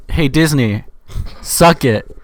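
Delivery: loud jokey taunt at Disney; defiant call-out. Real, not acted. Mocking Disney after a bad checkpoint, loud taunt. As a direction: shout it at Disney like a dare. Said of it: "I'm not actually telling you to suck it".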